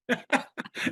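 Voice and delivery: high laugh. Real, not acted. Laughing at the punchline.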